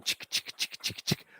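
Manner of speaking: whispered chick-chick of a train; train chugging noise. Shown as a demonstration. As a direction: chug like a little train. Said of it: "Now the train gets going".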